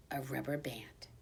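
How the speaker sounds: soft whispered reveal; secret whisper. A narrator's reading. Hamster reveals his secret weapon in a whisper.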